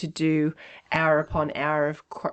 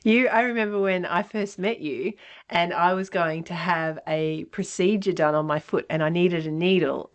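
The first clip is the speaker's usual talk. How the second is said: ordinary delivery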